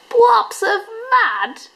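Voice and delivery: high drawn-out amused echo; amused surprise. A narrator's reading. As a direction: Dingo delighted by the idea. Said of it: "Dingo laughed".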